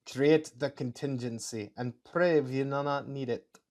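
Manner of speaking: put-on slow German accent slipping into Russian. Officer praising the scientist's work, slow German accent. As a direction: attempt a German officer, slowly, accent wobbling. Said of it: "my German accent slips a little into Russian".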